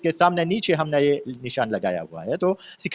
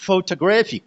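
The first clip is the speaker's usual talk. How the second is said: photo-GRAPH-ic, third syllable stands out; stress on third syllable; 2 semitones higher, much slower than usual. Shown as a demonstration. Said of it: "third syllable है".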